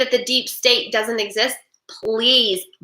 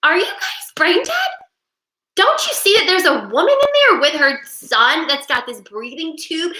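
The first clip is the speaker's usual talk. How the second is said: exasperated; 6 semitones higher than usual. Retold or imitated. Exasperated rant at online commenters.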